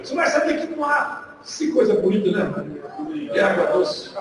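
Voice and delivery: normal talking voice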